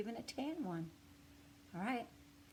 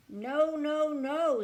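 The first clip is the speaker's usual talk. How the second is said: raised, higher insistent no no no; emphatic no; 8 semitones higher, 12 dB louder, much slower than usual. Acted. Mister Pine rejecting the paint colors, loud insistent refusal. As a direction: refuse firmly and loudly. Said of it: "said Mister Pine".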